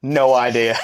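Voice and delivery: normal talking voice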